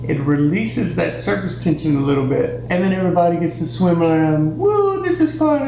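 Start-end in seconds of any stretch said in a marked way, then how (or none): 4.6-5.7 playful cheer. Acted. Cheering colors swimming.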